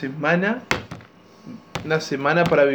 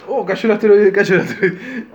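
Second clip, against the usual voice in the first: loud, raised mock-panicked cry; mock alarm; 5 semitones higher, 6 dB louder than usual. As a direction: cry out as if disaster just struck. Finge anunciar la caída del asteroide.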